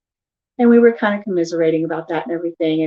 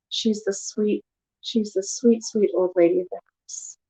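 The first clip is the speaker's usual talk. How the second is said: soft, doting murmur; tender cooing; 5 dB quieter than usual. Retold or imitated. Wife admiring sleeping old dog, tender and soft. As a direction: coo softly over a sleeping pet.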